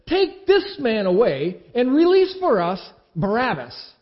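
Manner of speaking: loud, high, slow crowd cry; a mob crying out, slowed and loud. A narrator's reading. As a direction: call it out as a whole mob demanding. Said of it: "They all cried out together".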